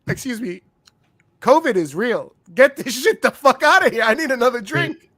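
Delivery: high, loud mock outrage; hammed-up disgusted shouting; outraged yell. Friend shouting at the waitress about covid.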